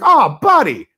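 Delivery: high, loud, exuberant movie-character greeting; big swooping cry of recognition; elevator guy. Retold or imitated. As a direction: call it out like an over-friendly elevator operator. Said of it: "The guy in the elevator was like".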